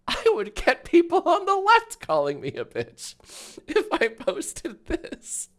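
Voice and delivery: cracking up mid-sentence; laughing. Real, not acted. Streamer laughing at being called a bitch by everyone.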